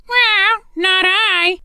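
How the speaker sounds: high, slow meowing cat voice. A narrator's reading. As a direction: meow it like a lazy cat. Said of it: "with a meow".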